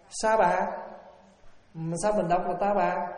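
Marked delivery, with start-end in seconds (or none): 0.1-0.7 "sa bà" said with an s sound; correct reading. Shown as a demonstration. Monk showing the correct reading of the word. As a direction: say "sa bà" with a clear s. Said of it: "nếu âm cho đúng thì đọc là".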